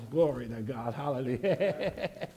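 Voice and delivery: low, soft aside of praise; quiet murmur of glory. Real, not acted. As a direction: breathe it under your voice.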